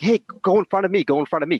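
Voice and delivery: hurried mock call-out; urgent invitation. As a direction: imitate someone waving others ahead. Mentor waving them ahead, acted out.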